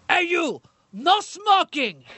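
a mock Schwarzenegger in Austrian accent scolding a smoker; Austrian accent barking. Crew member walks by smoking, Arnold yells in Austrian accent. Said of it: "Arnold takes the cigar to his mouth and goes".

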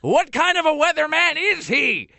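put-on high, outraged old man caller; old guy voice. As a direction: squawk like an offended old man. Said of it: "putting on his old guy voice".

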